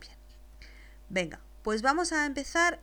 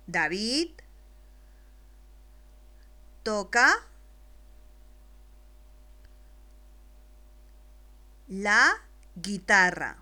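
each word alone, louder, with long pauses; slow dictation; 6 dB louder, slower than usual. Shown as a demonstration. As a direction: dicta palabra por palabra, despacio. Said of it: "después te la dicto". (dictado claro y lento para un niño)

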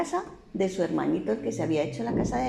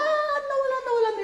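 flying away. A narrator's reading. Straw house flies away.

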